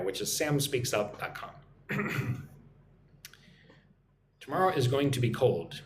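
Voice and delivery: normal talking voice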